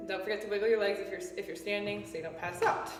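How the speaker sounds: ordinary talk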